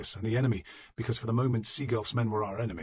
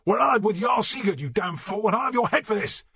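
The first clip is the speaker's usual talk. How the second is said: high, loud enraged shouting; furious lord yelling at fools; shaking with fury; 11 semitones higher, 6 dB louder than usual. A narrator's reading. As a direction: rage and threaten at full voice. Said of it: "Sigurf was shaking with fury".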